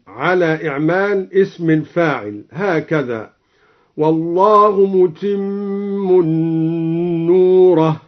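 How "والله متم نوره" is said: "متم" with tanween, mutimmun, and "نوره" in the accusative, slow; Duri reading. Shown as a demonstration. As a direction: recite "متم" with nunation. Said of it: "قرأ الدوري متم بالتنوين ونصب نوره". ("متمٌّ نورَه" بالتنوين والنصب)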